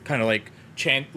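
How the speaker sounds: normal talking voice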